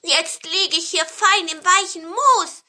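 high, loud, gleeful storybook voice; delighted pine cone. A narrator's reading. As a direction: crow happily from a safe hiding place. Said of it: "der Tannenzapfen freute sich". (hohe, vergnügte Tannenzapfenstimme)

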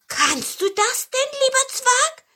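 very high, loud wondering question; hopeful amazement. A narrator's reading. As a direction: ask it wide-eyed in a piping voice. Cone asks in hopeful amazement. (hohes, staunendes Fragen)

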